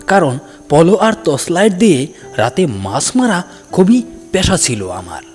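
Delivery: plain reading voice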